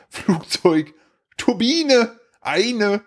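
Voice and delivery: put-on high child voice, word by word; small high voice, separate words; imitating toddler son. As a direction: mimic a toddler announcing a discovery. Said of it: "meinte nur".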